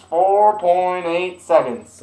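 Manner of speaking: slow, stretched arena announcer call; drawn-out announcer. Retold or imitated. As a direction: drag it out like an arena PA. Announcer repeats time.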